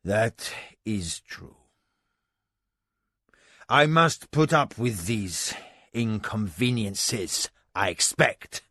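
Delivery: slow, subdued, calmer admission; mild sigh. Acted. Husband unexpectedly mild with his wife. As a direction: let the anger drain out. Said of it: "a mildness she had never seen".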